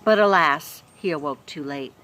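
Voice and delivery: expressive storybook reading; lively read-aloud voice; story narration. A narrator's reading. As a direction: read it like a bedtime chapter. Reads chapter aloud to children.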